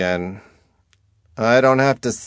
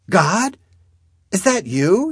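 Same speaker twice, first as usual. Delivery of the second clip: high, loud questioning cry; raised, high-pitched plea; 11 semitones higher, 8 dB louder than usual. A narrator's reading. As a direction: call up to God in desperate hope. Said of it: "she cries".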